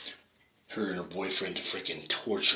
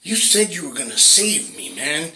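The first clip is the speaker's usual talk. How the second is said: higher, louder scolding; angry God; 7 semitones higher, 6 dB louder than usual. Acted. God berating the drowned man, higher, louder and scolding. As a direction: throw his words back at him.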